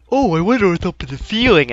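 a light mock of a naive player; mocking dumb self. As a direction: play the oblivious fool. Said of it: "I'm not gonna be an idiot".

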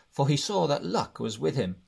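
ordinary delivery, nothing marked

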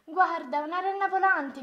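put-on high, loud boy's cry; amazed shout. A narrator's reading. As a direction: shout to a friend to look up. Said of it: "gridò uno". (grido di stupore)

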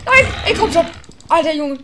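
loud, high exasperated cry; real gamer rage; frustrated shout. Real, not acted. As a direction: yell in frustration. Losing fight, frustrated.

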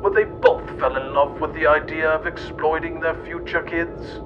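usual speaking voice

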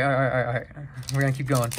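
usual speaking voice

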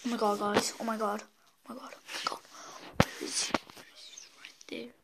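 breathless scared whisper; scared horror game streamer. Real, not acted. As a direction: whisper in shock after escaping. Hiding after the chase.

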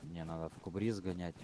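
ordinary talk

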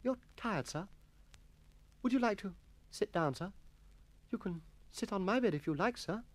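soft, high schoolboy solicitude; tender sympathy. A narrator's reading. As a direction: fuss gently over a weary master. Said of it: "tender sympathy".

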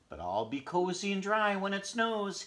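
high, loud little hedgehog; hopeful hedgehog insisting. Acted.

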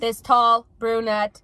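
loud, drawn-out "tall brunette"; slow, emphatic vibes reading; dramatic reading. Acted. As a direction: stretch each word of TALL BRUNETTE with force. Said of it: "no one said read it like that".

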